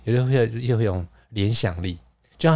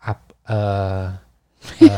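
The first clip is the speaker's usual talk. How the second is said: ordinary talk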